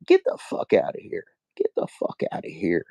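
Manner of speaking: fading to a whisper. Real, not acted. Vlogger waving off news channels, fading to a whisper. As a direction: let the dismissal die away to a breathy whisper.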